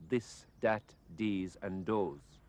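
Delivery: "This, dat, dees, and does" with d in place of th; slow Irish "dis, dat, dees, and dose" for "This, dat, dees, and does"; Irish th pronunciation. Shown as a demonstration. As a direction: say "This, dat, dees, and does" slowly, each starting on a hard d. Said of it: "difficulty with pronouncing the T H sound".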